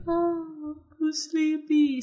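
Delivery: high, slow put-on little girl voice; fake sleepy child. Mother imitating daughter hiding tears with a yawn. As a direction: mimic a child pretending to be sleepy. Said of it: "she yawned again".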